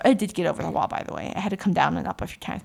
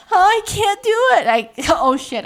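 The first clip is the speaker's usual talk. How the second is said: high, loud, fast wail of fear; mock panic; 11 semitones higher, 8 dB louder, faster than usual. Recalling freezing on the wall, a high fast panicked cry. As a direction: blurt it out panicking.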